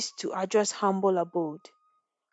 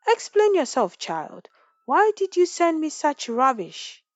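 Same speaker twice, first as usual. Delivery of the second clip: stern demand; 8 semitones higher, 4 dB louder than usual. A narrator's reading. Angry chief confronting girl.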